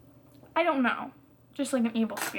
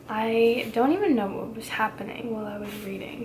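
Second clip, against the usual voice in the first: usual speaking voice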